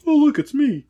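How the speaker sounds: hammed-up wonder; mock amazement. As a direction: marvel at seeing yourself. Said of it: "I've never been like".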